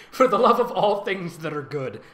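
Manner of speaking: usual speaking voice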